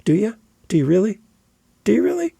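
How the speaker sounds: skeptical comeback. Acted. Podcaster challenging someone who says you have to promote, skeptical. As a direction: push back with doubting, rising questions. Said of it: "I come back with".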